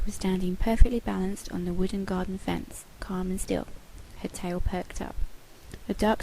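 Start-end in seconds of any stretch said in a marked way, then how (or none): none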